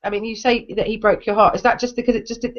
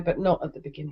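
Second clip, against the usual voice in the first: softer, trailing repeat of "not at the beginning"; quieter repeat; 6 semitones lower, 9 dB quieter than usual. Real, not acted. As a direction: drop it to a soft afterthought. Repeats point, second softer.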